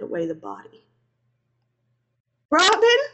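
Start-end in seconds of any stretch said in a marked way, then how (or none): 2.5-3.0 very high, loud cry; scream. A narrator's reading. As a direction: scream out a loved one's name. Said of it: "I screamed".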